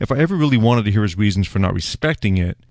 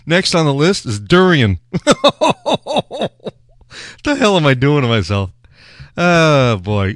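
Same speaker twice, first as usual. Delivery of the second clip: louder, high laughing disbelief; laughing in dread; 6 semitones higher, 4 dB louder than usual. Real, not acted. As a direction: laugh at your own bad idea. Dreading the durian tasting.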